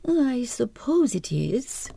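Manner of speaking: a hint of an uneasy nurse, slow; the nurse, half believing, holding back; doubtful nurse.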